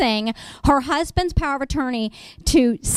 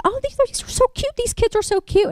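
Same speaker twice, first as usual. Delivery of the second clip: much higher cooing voice; gushing over kids; 13 semitones higher than usual. Retold or imitated. Attorney cooing over kids in her waiting room. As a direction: coo over the cute kids.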